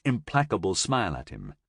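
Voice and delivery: ordinary talk, nothing marked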